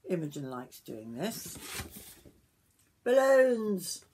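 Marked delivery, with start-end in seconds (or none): 3.0-4.0 loud, high, drawn-out exclamation; excited reveal. A narrator's reading. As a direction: announce the surprise to the children. Revealing the answer to children.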